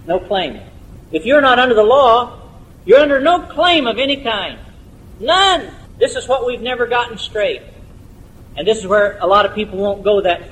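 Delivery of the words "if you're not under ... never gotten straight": high, forceful pulpit insistence; impassioned preaching. Real, not acted. Preacher insists to congregation no claim remains, high and emphatic. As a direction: drive it home, climbing to a high final word.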